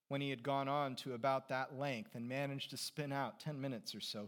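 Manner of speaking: ordinary delivery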